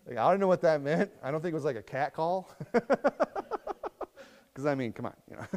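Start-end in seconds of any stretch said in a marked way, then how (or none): 2.5-4.2 high amused laugh. Real, not acted. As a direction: chuckle at the idea.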